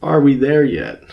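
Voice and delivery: a mild mock yell, like an impatient kid; mock scream. Gut screaming at oneself, mild mock scream. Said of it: "that's what my gut screams".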